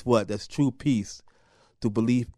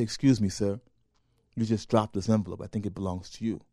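softer, lower, polite; soft, lower, respectful; 4 semitones lower, 6 dB quieter than usual. Retold or imitated. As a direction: hand it back gently. Polite young man to elder.

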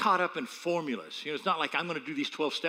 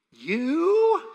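high, loud disbelieving "You?"; incredulous mother; 11 semitones higher, 9 dB louder than usual. Retold or imitated. Mother doubting her son could be a pastor, incredulous shout. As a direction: scoff in disbelief like mom. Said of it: "She goes".

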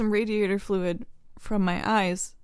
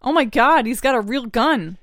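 loud, startled exclamation; alarmed cry; 2 semitones higher, 9 dB louder than usual. Acted. Kid warning a friend about the robber's gun, alarmed. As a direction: blurt it out in alarm.

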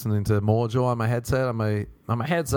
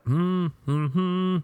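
high hummed tune; humming; 7 semitones higher, much slower than usual. Acted. Comedian at the gym humming without knowing it, humming. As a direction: hum away obliviously. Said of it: "I started humming".

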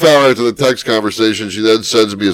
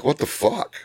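mock disbelief; 10 dB quieter than usual. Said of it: "But I'm like".

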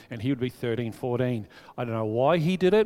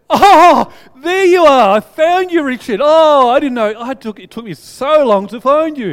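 very high, very loud mock surprise at finding him; hammed-up surprised brother; 10 semitones higher, 15 dB louder than usual. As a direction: overplay the delight of finally finding the kid. Brother's exaggerated find.

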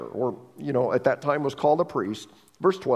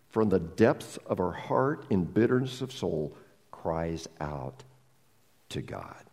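ordinary talk, nothing marked

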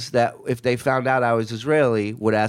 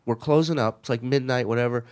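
ordinary talk

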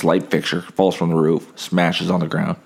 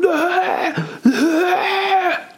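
mock coughing fit. Man behind in line coughs openly, imitated hacking cough. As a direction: hack it out without holding back. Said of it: "He starts coughing".

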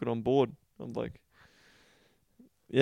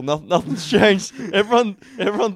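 laughing; 8 semitones higher, 8 dB louder than usual. Real, not acted. Laughing while admitting bluntness.

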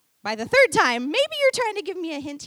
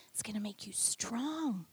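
hushed whisper; 4 semitones lower, 11 dB quieter than usual. Acted. Preacher whispering strength to congregation, hushed. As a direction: whisper it, almost unvoiced. Preacher drops to hush on promise.

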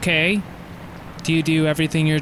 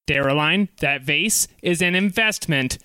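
ordinary delivery

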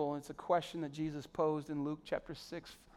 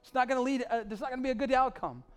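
louder and higher warning; urgent warning; 7 semitones higher, 6 dB louder than usual. Acted. Friend warning of a bad outcome, loud plea. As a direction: sound the alarm.

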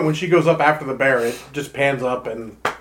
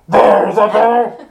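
Batman voice changer; 6 semitones higher, 8 dB louder than usual. Pretend. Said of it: "This is the Batman version".

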